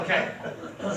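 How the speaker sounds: chuckling through the word; amused, laughing agreement; okay said through a breathy laugh. Retold or imitated. Retelling a line with a laugh.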